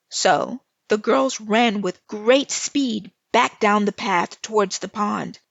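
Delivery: ordinary delivery, nothing marked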